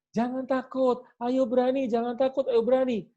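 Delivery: put-on high, urging encouragement to a scared child; teacher's coaxing. Teacher urging a frightened child on the flying fox, coaxing. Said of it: "kalau si guru bilang".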